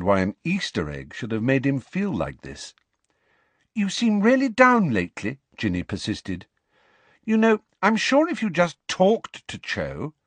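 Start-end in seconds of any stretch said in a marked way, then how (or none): 3.7-10.1 higher-voiced girl, gently pressing; a caring friend nudging him to open up, higher; Ginny persisting. Ginny presses Harry about Cho, in a higher, gently insistent voice.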